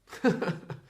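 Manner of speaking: a real burst of sheepish laughter; embarrassed laugh. Real, not acted. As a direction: laugh at yourself for forgetting. Forgot the phrase, laughs.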